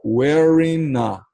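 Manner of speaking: "Wearing a" very slow, the final N of "wearing" run straight into "a", no G sound; linked wearing a. Shown as a demonstration. Teacher showing students how to link the N of "Wearing a". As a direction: link "Wearing a" as one smooth, slow word, dropping the G. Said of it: "você emenda a letra N na pronúncia com a próxima vogal".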